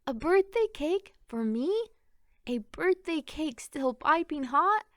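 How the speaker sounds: a mother overjoyed at her surprise cake; delighted cry. A narrator's reading. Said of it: "she cried".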